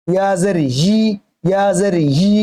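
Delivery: "ই" as yi, with a drawn y before the vowel; y-glide onset. Shown as a demonstration. Teacher shows correct ya, long yi. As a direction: press a y into the last "ই". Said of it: "উচ্চারণটা শুনলে বুঝতে পারবেন".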